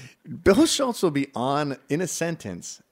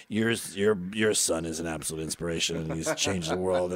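low, softer mumbled tribute; imitated drunken praise; 6 semitones lower, 4 dB quieter than usual. Drunk friend praising son to dad, low confiding mumble. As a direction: drop into a low earnest murmur. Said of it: "So he'd be like".

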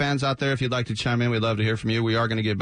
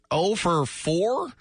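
normal talking voice

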